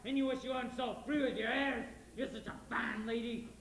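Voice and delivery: bitter, rising to a shout; furious hired hand shouting at the lady; angry outburst. Acted. Judd's bitter outburst at Laurie.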